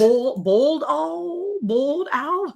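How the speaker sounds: high, drawn-out Texas "bold owl" for boiled owl; Texas pronunciation. Shown as a demonstration. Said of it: "how they say it in Texas".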